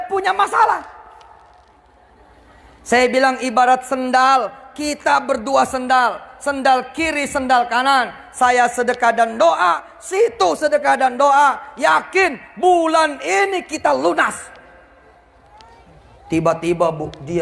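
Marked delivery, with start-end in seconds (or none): 3.4-14.4 slow, rising to a fervent shout; passionate persuasion. Retold or imitated. Debtor urging the creditor to join him, building to a shout.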